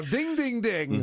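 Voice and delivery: high, bright game-show dings; ding ding, you got it, game-show style; playful ding-ding announcement. Real, not acted. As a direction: ring it out like a quiz host awarding points. Host awards correct guess.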